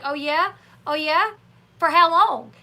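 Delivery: retold challenge. Amber challenging Gary over taking Leah, mock confrontational.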